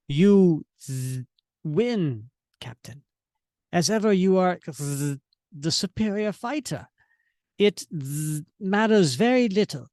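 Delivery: stuttering robotic voice, z- glitches; a hint of a broken machine speaking; mechanical villain. Defeated Arnim Zola to Captain, glitching robot voice. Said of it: "spitting out his mechanical words".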